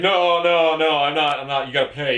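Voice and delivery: insistent, rattled imitation; freaking weird. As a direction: imitate a flustered delivery guy. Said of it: "like, freaking weird about everything".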